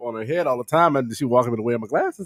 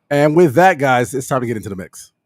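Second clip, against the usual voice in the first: host segue; 7 dB louder than usual. Real, not acted.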